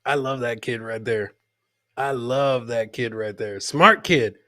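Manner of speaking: normal talking voice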